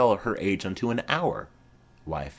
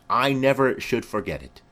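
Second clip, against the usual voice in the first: usual speaking voice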